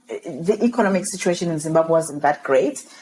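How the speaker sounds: normal talking voice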